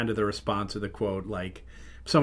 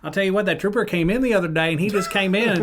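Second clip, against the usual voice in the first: higher, louder chummy stranger voice; overfamiliar cashier; 7 semitones higher, 4 dB louder than usual. Retold or imitated. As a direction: gab at a customer like a best buddy. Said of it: "starts talking to me like I'm his best friend in the world".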